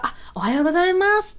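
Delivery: high, bright-pitched imitation of a coworker's hello; coworker's greeting. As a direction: mimic a coworker greeting you first. Imitates coworker greeting her first.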